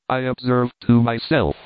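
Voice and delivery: ordinary delivery, nothing marked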